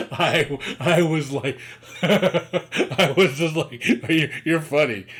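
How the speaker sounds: laughing. Real, not acted. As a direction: let the laughs break through the words. Laughing at friend's claim.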